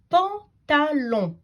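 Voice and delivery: "pantalon" in three slow, separate beats; syllable by syllable. Shown as a demonstration. Teacher introducing the next word, "pantalon" chopped into syllables. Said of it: "à les découper en syllabes".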